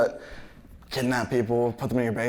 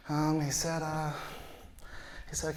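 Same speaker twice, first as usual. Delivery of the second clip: lightly voiced child, higher and hesitant; reluctant kid; 6 semitones higher, 9 dB quieter than usual. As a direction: stall like a small kid afraid to tattle. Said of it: "he's looking down".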